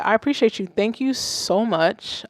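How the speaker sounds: ordinary talk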